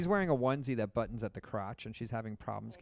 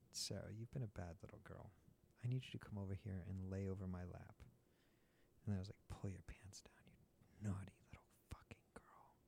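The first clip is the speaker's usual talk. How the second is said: low, breathy teasing whisper; softly growled bedroom command; hushed mock dom whisper; 4 semitones lower, 14 dB quieter than usual. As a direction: whisper it like a seductive threat. Acted dominant spanking scene.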